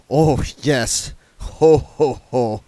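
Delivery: a bored vampire sneering at a joke; sarcastic ho ho ho. Acted. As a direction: fake a laugh with no joy in it. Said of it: "he said".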